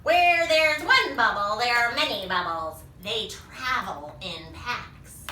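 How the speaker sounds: slow, emphatic warning; loud dire warning, drawn out; Mogo. Acted. Monster warning how dangerous bubbles are, slow and emphatic. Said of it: "That's what Mogo's saying".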